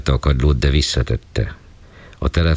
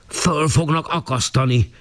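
higher, slow, bellowed verdict; beside himself, yelling; 13 semitones higher than usual. Acted. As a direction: pronounce the sentence as if beside yourself. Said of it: "üvöltötte szinte magán kívül".

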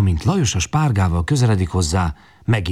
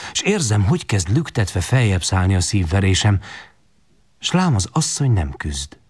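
ordinary delivery

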